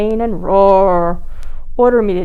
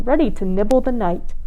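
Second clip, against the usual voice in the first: usual speaking voice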